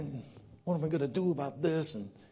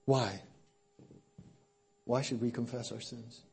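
normal talking voice

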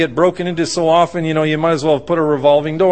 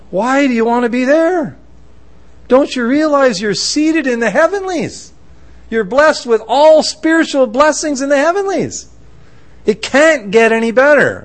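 higher-pitched, incredulous urging; emphatic exhortation; 8 semitones higher than usual. Acted. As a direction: press them with rising fervour. Exhorting a downcast believer.

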